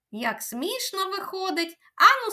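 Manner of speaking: ordinary talk, nothing marked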